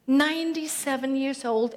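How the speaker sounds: ordinary talk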